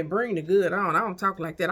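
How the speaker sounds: normal talking voice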